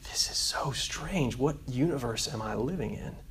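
soft, slowed disbelief on a retold quote; hushed amazement. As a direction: quietly voice a bewildered boy. Boy amazed by family dinner.

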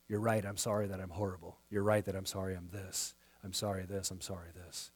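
imitating someone grovelling; a quiet, beaten-down apology; mock meek apology. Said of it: "this person began to apologize".

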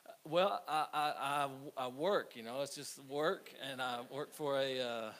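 hammed-up panic at the passport desk; nervous stammer. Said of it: "he can tell I'm nervous".

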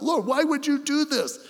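a prophet's anguished why, held up high; crying out to God. Retold or imitated. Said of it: "he's crying out".

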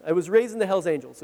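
usual speaking voice

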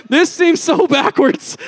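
难以置信; disbelief. Retold or imitated. Disbelief at armed escort in slums.

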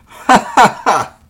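loud, high burst of mocking laughter; big theatrical devil's laugh; shouted laughter. A narrator's reading. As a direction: roar with laughter at the young man. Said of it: "shouted he again and again".

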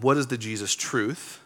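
usual speaking voice

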